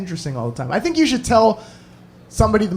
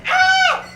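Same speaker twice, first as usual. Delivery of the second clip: high imitated shriek; sudden piercing-pitched scream, held briefly; woman's scream. Demonstrating the scream heard through headphones, high shriek. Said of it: "'cause that's how loud it was".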